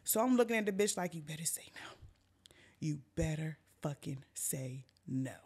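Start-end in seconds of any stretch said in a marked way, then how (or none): 1.1-1.9 whispered, low threat; hushed warning. Retold or imitated. Storyteller to friend being questioned about drugs, hushed warning. 2.8-5.4 low, soft, word-by-word threat; menacing warning. Retold or imitated. Storyteller to friend questioned about drugs, measured menacing warning.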